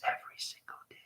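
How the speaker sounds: hushed, whispered words; dropping into a whisper. Shown as a demonstration. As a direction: let the voice fall away to a whisper. Drops to whisper to demonstrate.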